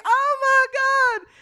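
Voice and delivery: very high, stretched mock shriek; slow, loud, high-pitched cry of alarm; panicked fan scream. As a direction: scream in sudden realization, imitating her. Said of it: "And she's like".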